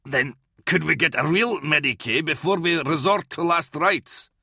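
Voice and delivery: snarl. A narrator's reading. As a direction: snarl impatiently for a real medic. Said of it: "Kalia snarled".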